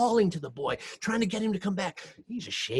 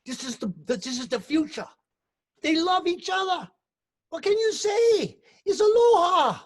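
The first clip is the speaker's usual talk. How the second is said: louder, higher fatherly plea; father calming mama-san; 8 semitones higher, 7 dB louder than usual. Retold or imitated. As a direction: talk her down, rising as you go. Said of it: "And he say".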